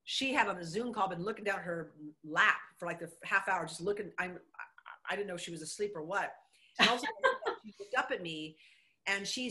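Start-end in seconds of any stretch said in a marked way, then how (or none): none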